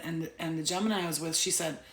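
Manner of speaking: usual speaking voice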